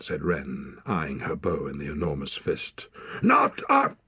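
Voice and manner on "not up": high, loud, drawn-out command; shouted order. A narrator's reading. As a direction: bellow them out of your valley. Wild man orders them out angrily.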